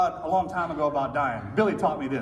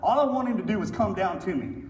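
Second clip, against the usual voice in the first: ordinary delivery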